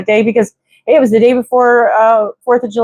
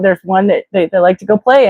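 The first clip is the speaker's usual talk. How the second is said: ordinary delivery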